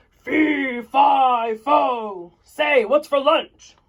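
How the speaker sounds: loud, slow giant's voice; booming giant. Acted. Mister Giant stomping toward the kitchen, slow booming call. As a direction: boom it out like a huge giant.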